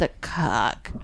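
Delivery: mock low romance-hero delivery; imitated book hero. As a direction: drop deep to mimic how that dude talks. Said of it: "Anybody that talks like that".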